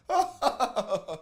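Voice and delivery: high, delighted cackle; gleeful laughter. Real, not acted. Host cracking up at courtroom ejection, gleeful laugh. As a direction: burst out laughing.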